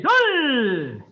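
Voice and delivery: long, loud falling call; shouted count. Real, not acted. Instructor counting kicks for the class, shouted command. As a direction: bellow it out, stretched and falling.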